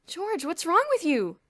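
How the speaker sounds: loud, high alarmed cry; cried out. A narrator's reading. Boots crying out to his waking roommate in alarm. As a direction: raise a fake alarm at your roommate. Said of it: "Boots cried".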